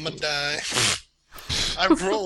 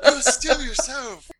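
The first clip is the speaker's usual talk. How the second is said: mock fortune cookie; 10 semitones higher, 7 dB louder than usual. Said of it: "mocking the fortune line".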